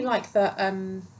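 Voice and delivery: normal talking voice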